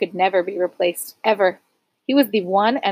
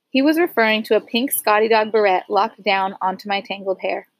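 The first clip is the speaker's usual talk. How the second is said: ordinary delivery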